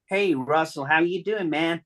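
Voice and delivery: a hint of Diane's friendly phone voice; imitating Diane. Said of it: "I see it's Diane".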